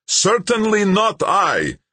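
high, slow, drawn-out yell; yelled reply. A narrator's reading. Malik answering Dan over the boat's roar, yelled reply. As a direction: yell it back across the noise. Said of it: "Malik yelled back".

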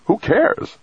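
very high-pitched, snappy retort; snapped retort. A narrator's reading. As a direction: snap it back, pitch leaping up. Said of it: "Dex snapped".

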